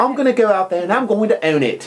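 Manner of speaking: mock English accent, lightly done; half-hearted English accent owning the moment. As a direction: say it like a plucky English lady. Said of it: "That's, that's my English accent".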